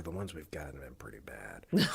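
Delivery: whispered, low dig; hushed aside. Pretend. Co-host sneaking in a dig about listener suggestions, hushed aside. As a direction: drop to a near whisper.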